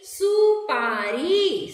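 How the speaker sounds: सुपारी drawn out very slowly, syllable by syllable; slow syllable blending. Shown as a demonstration. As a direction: stretch सुपारी out slowly for the children. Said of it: "र में बड़ी की मात्रा री".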